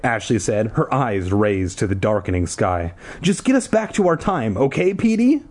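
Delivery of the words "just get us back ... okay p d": put-on high-pitched, pressing girl voice; urgent plea. A narrator's reading. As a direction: urge him in a high voice. Ashley pleads urgently in story.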